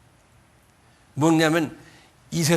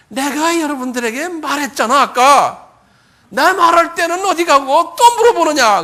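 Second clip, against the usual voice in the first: high, loud acted exasperation; indignant, hammed-up protest at full volume; exasperated retort; 10 semitones higher, 10 dB louder than usual. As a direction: snap back at repeated questioning in a raised voice. Healed man annoyed at repeated questioning.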